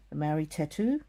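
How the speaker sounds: ordinary talk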